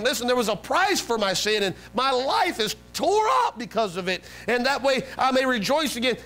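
high, impassioned plea; begging God. Retold or imitated. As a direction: plead your case with God. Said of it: "he's begging to God".

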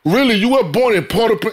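can't believe it, lift it up; surprised. Real, not acted. Host surprised the guest was born in Haiti.

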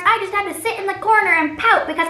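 put-on high, loud whining child voice; whiny kid. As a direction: whine like a hungry brat. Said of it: "He's like".

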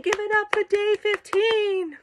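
high, stretched-out sing-out; slow, high announcer-like call for applause; celebratory cheer. Real, not acted. Reader hailing day fifteen to viewers, high drawn-out cheer. Mock cheer ending the reading.

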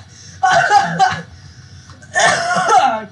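high, loud burst of laughter; loud laughing fit. Real, not acted. Gamer cracking up after a fright, loud laughter. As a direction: crack up helplessly, real laugh.

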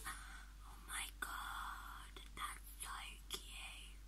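very quiet, breathy whisper; tiny, drawn-out whispered coo; overcome by cuteness. Real, not acted. Overcome by new baby's name.

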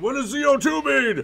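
very high-pitched pretend interrogation; hammed-up demanding question, pitch way up; mock interrogator. Mock border interrogation of band name.

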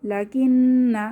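লাকিন্না recited very slowly with a long held final vowel; drawn-out recitation. Shown as a demonstration. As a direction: stretch লাকিন্না out at the pause. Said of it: "এটা পড়বো, লাকিন্না".

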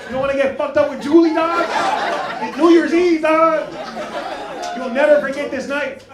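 loud, high-pitched hype; hyped-up peer pressure. Pretend. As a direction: egg a friend on loudly. Hyping up a reluctant audience member.

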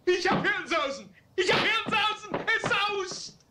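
high, breathless rant with a laugh in it; frantic babbling. Acted. Father raving that his brain is buzzing, frantic and high. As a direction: rattle it off like your head is spinning. Father feels madness coming on.

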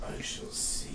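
low, soft, whispered dragon; a dragon breathing the words low. Acted. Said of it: "[name] has a great dragon voice".

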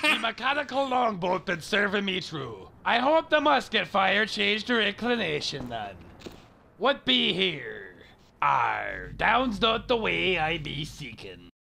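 lightly voiced pirate, farmer-ish West Country. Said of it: "He sounds like a farmer".

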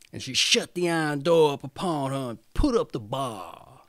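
usual speaking voice

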